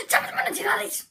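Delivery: hyped Irish gamer intro; Jacksepticeye impression. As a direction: boom it out eagerly. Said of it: "Like, really loud".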